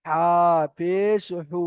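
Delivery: no lengthening on the last হু; short final vowel. Shown as a demonstration. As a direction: stretch the names হা পেশ, then a brief হু. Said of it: "এখানে টান হবে না".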